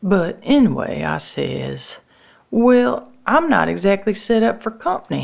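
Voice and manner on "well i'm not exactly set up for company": high-pitched, hedging protest; reluctant excuse. Acted. Sister putting off brother's visit, reluctant. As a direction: hedge with a high 'well', then hurry on.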